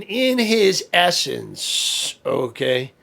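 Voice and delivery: ordinary delivery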